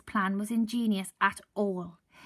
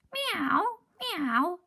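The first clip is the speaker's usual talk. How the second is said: higher pitiful cat meow; 10 semitones higher, 5 dB louder than usual. Acted. Seasick cat Hamish agreeing, pitiful high meow. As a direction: mew it miserably. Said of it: "agreed Hamish pitifully".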